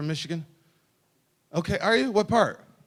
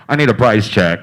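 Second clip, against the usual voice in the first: booming store-clerk announcement; mock loud call; 5 semitones lower, 13 dB louder than usual. Cashier calling to the stock room, loud mock announcement. Cashier calls for price check.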